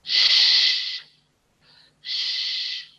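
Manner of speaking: hissed sh sound, lips rounded; teacher demo of "shh"; drawn-out shh. Shown as a demonstration. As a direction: model "Shh" as a long unvoiced hiss. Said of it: "So I say".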